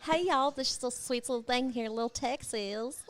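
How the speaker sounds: a hint of a Texan accent; mock Texas accent. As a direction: try out a playful Texan twang. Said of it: "maybe that's how Texas people talk".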